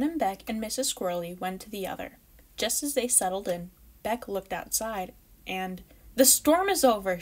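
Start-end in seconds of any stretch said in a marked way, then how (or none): 6.1-7.2 higher, louder happy cry; a squirrel kid calling out gladly; excited cry. Acted. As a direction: burst out with the news. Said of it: "she cried".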